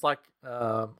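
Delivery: usual speaking voice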